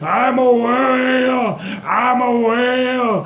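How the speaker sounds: high, slow, sustained bellow; whale creature. Acted. Joe merged with the whale announces himself, drawn-out high bellow. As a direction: stretch it into a long silly wail.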